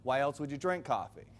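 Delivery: normal talking voice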